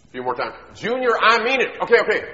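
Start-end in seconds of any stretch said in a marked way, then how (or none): 0.8-1.8 high, loud, insistent mom; mom's warning shout. Acted. Mom warns again. 1.8-2.3 put-on high, loud, put-off kid reply; nagged son. Boy answers nagging mom.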